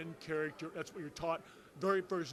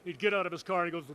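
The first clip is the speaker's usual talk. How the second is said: ordinary talk, nothing marked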